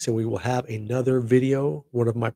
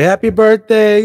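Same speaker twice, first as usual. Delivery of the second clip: much higher and louder, slowed, a big celebratory happy birthday; excited cheer; 11 semitones higher, 14 dB louder, slower than usual. Real, not acted. Vlogger cheering his channel's fourth birthday, excited. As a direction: belt it like a party cheer.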